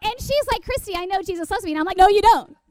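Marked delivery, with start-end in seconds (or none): 0.6-1.7 higher, quick protest; exasperated friend. Retold or imitated. Friend answers the zealous speaker, high impatient protest. 2.0-2.6 loud, high comic outburst; vehement contradiction. Retold or imitated. Zealous teen shouts down her friend, hammed-up loud denial.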